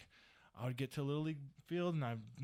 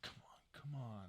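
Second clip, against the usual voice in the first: under-the-breath, impatient whisper; hushed urging; 11 dB quieter than usual. Retold or imitated. Parent on the sideline to daughter, hushed impatience. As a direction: urge her on without being heard. Frustrated parent watching soccer.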